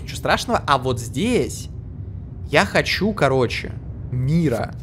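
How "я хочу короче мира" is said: ordinary talk, nothing marked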